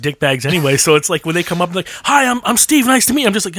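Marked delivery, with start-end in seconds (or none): 2.1-3.3 high-pitched, eager mock introduction; friendly stranger. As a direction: imitate someone a bit too keen to meet. Imitates an overly friendly stranger approaching.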